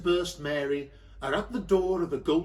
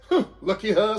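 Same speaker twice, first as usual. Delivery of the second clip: usual speaking voice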